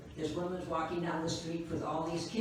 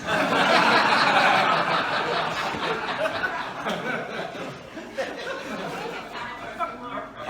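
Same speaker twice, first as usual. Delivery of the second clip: loud, long rolling laughter; loud sustained cackle; 5 semitones higher, 9 dB louder than usual. Real, not acted. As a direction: let the laugh roll out. Long laugh after punchline.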